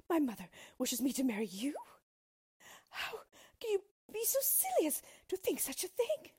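high-pitched incredulous protest; scornful, shocked princess voice; aghast princess. A narrator's reading. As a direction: climb very high in disbelief.

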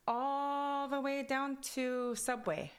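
put-on high, stretched-out Kwakwala-accented English. Telling a friend where she's going, drawled Kwakwala accent. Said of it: "my accent from Kwakwala goes into English".

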